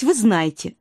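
ordinary delivery

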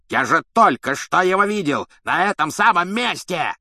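loud, high-pitched raging protest; furious yell. A narrator's reading. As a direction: bellow in outraged disbelief. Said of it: "с бешенством заорал палач".

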